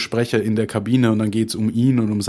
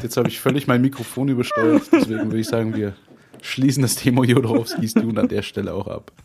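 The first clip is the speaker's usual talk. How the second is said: ordinary talk, nothing marked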